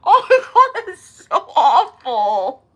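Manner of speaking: high, loud, helpless laughter; cracking up, words stretched by laughs; laughing hard. Real, not acted. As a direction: cry it out through real laughter.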